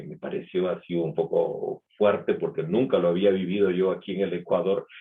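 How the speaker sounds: usual speaking voice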